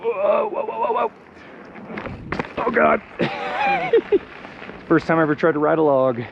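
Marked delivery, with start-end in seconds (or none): 0.0-3.0 high alarmed whoas; startled whoa. Real, not acted. Riding over a log. 3.1-4.8 high relieved laugh; nervous laugh. Real, not acted. Laughs after log ride.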